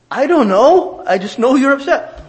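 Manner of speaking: husband's exasperated reply. Retold or imitated. Husband arguing with his wife late at night, exasperated and loud. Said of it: "she'd say".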